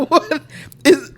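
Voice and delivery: high and loud, baffled by her own tears; asking herself with laughing disbelief; bewildered. Retold or imitated. Retelling panic attack confusion.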